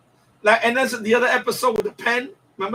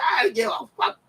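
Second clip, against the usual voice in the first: mock defiant yell; 5 semitones higher than usual. Gangster's defiant retort.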